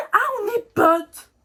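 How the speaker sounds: high, loud sarcastic echo; indignant mock repeat. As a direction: parrot his words back with scorn. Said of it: "j'étais très énervée".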